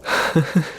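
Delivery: short breathy chuckle; scornful chuckle. Acted. Emperor amused by his wife's notions, scornful chuckle. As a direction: laugh off a silly notion.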